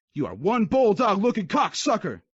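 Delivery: high insulting yell. Acted. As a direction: scream it into his face. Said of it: "he yelled inches away".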